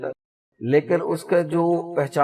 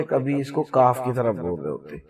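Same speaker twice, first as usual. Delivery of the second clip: ordinary delivery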